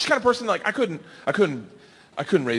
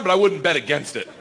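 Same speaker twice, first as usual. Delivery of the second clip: usual speaking voice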